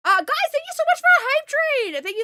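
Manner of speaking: high, loud thrilled thanks; excited thanks. Real, not acted. Streamer thanking chat for the hype train, thrilled. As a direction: gush at the chat.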